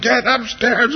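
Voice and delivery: high-pitched mock yell; imitated parent's yell. Father ordering his kids upstairs, mock high yell. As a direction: re-enact yelling at the kids, pitch jumping up. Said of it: "I'm just yelling".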